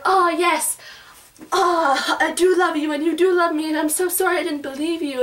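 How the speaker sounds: hint of a swooning heroine; mock heroine. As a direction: gush a tearful confession, lightly. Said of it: "she finally at the end is like".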